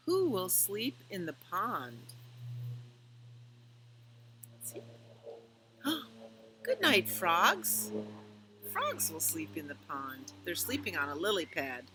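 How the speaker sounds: gentle, lilting picture-book read-aloud; reading to children. A narrator's reading. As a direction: read it slowly to little ones at bedtime.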